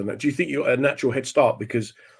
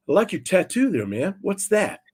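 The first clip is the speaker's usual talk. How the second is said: high-pitched imitation of a laid-back friend; Ronnie Edwards; 7 semitones higher than usual. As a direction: play the innocently curious Canadian-Jamaican. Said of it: "Ronnie looks at him and goes".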